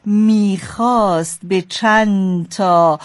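very slow, dragged out; slow motion. Acted. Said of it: "قرار شد تو هم اسلو موشن بگی".

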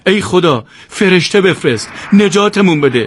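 the plea swells to a cry; urgent prayer. A narrator's reading. Yousef praying for an angel, building to a loud high plea.

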